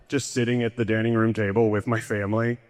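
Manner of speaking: ordinary talk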